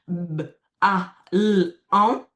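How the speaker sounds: "b, a, l, on" as four separate sounds; segmented phonemes. Shown as a demonstration. Player asking for a card, "b, a, l, on" segmented sound by sound. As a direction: sound out "b, a, l, on" with a pause after each. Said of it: "je segmente".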